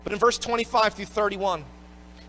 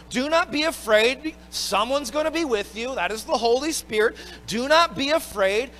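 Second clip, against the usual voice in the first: louder, rising exhortation on "Do not be afraid"; fervent preaching; 3 semitones higher, 4 dB louder than usual. Acted. Preacher urging the congregation not to fear, louder and fervent. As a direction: drive the comfort home with building force.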